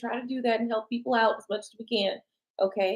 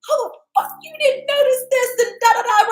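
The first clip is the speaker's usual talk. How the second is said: high, loud mock outrage; mock furious; 6 dB louder than usual. Imitating an angry partner who went unnoticed, high mock outrage. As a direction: fling it out like a fed-up partner. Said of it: "You're pissed".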